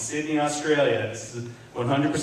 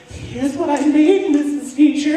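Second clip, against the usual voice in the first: put-on proud kid voice; 9 semitones higher than usual. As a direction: play an excited little kid showing off. Child voice presenting to teacher.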